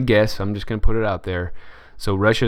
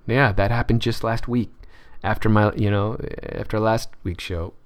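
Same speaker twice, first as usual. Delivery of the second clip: normal talking voice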